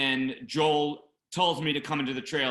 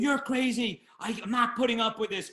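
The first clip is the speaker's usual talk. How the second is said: high, indignant outburst without much volume; re-enacted angry protest, pitched way up; retold yelling back; 9 semitones higher than usual. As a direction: act out yelling back at a screaming boss. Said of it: "then I start yelling at him".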